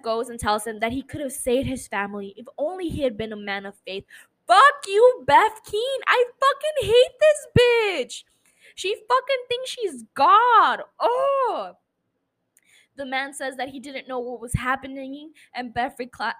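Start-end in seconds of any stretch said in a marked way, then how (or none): 4.5-11.7 furious outburst. Real, not acted. Furious at a show character.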